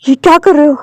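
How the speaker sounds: startled call out. Acted. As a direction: call out in alarm. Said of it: "वांगजी आवाज़ देता है".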